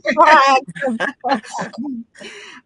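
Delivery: loud laughing burst. Real, not acted. Host teasing Naomi, loud laughter. Banter with guest.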